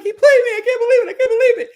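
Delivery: high, loud sobbing disbelief; mock crying wail. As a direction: wail it out betrayed. Huda crying voiced.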